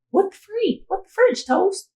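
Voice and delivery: high-pitched exasperated disbelief. Retold or imitated. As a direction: reenact fretting with a squeaky, incredulous voice. Acted exasperated quote, mock swearing.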